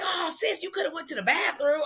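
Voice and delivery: high-pitched, exasperated brother; mock exclamation. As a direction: imitate his protest way up high. Brother's exclaimed protest in retold exchange.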